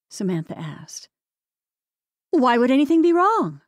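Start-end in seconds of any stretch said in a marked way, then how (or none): 2.3-3.6 higher, louder forced cheer; upbeat fake tone. A narrator's reading. Mother deflects daughter's question, upbeat fake tone. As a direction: brightly deny anything's wrong. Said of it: "in the upbeat fake tone again".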